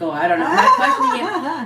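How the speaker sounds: high, squeaky-high laughing reply; amused hands-off protest through laughter; laughing dismissal. Retold or imitated. Laughing off his pimp claim.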